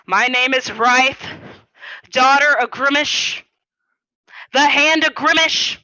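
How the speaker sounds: grand half-orc announcing herself to the room; bold proclamation. Acted. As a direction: proclaim each title proudly, with pauses between. Player's half-orc announces herself, slamming table.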